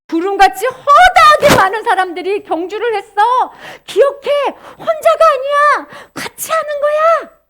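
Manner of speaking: high, loud rallying call; emphatic encouragement. Acted. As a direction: cheer on someone who feels alone. Voices God's encouragement to the believer.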